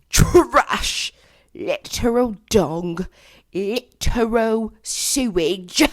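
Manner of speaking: loud, drawn-out scornful verdicts; emphatic disgust. Real, not acted. Venting about guys who sucked, theatrical disgust. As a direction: spit out each insult slowly, hammed up. Ranting about bad exes.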